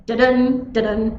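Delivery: two "da-dun" beats, each "dun" stressed; unstressed-stressed pattern. Shown as a demonstration. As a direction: tap out "da-DUN, da-DUN". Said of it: "that unstressed, stressed pattern".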